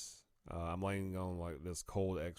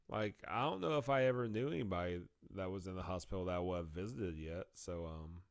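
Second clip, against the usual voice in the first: normal talking voice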